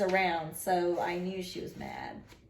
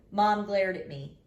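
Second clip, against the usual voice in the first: plain reading voice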